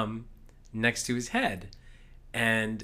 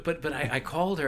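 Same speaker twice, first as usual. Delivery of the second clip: normal talking voice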